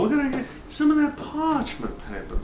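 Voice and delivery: high Southern drawl on "parchment paper"; hammed-up Southern cook. Shown as a demonstration. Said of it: "You gotta speak like that with a southern drawl".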